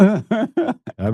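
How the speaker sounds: slow, chuckling through it; amused protest with a laugh. Real, not acted. Joe laughing as he protests he didn't marry his mother.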